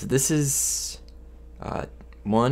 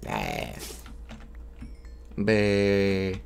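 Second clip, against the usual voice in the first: zombie; 3 dB quieter than usual. Acted. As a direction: groan it out like the undead. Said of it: "Is my zombie impression".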